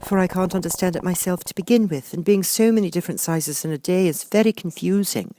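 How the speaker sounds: put-on high girl's voice, polite; earnest young girl explaining; polite Alice. A narrator's reading. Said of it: "Alice replied very politely".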